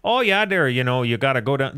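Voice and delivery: put-on sing-song Minnesota accent. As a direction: give directions like a chatty Minnesotan. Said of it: "the Minnesota voice there".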